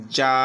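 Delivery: "যা" with the "aa" drawn out one beat; one-beat stretch. Shown as a demonstration. Teacher reading first for learners, stretched "যা". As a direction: lengthen the vowel of "যা" evenly. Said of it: "এক টান হবে শুধু".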